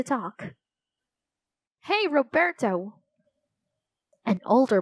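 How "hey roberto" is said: calling out. A narrator's reading. Older boy calls across the field.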